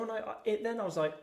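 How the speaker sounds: ordinary talk, nothing marked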